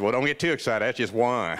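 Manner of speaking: low-pitched imitation of a Southern trainer; deep Southern trainer giving instructions; Southern accent. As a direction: play the coach with a Southern accent. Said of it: "he has this wonderful Southern accent".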